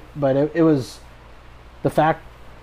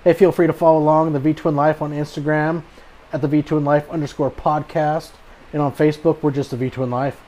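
podcast outro; 2 semitones higher, 3 dB louder than usual. Acted. Host reads podcast outro.

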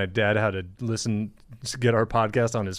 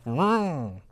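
higher-pitched toy-car vroom; imitated engine vroom; 4 semitones higher than usual. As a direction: mimic a tiny engine revving. Imitates raccoon's little car engine.

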